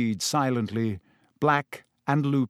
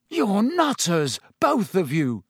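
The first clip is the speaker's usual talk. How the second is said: shaky; 5 semitones higher than usual. A narrator's reading. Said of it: "said Ron shakily".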